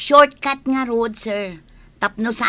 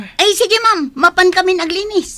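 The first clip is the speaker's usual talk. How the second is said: flustered compliance; 6 semitones higher, 6 dB louder than usual. Acted. Caught sleeping, hurries to obey.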